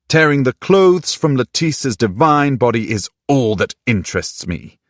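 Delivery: hurt man mocking back coldly; angry sarcasm. A narrator's reading. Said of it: "His eyes were angry".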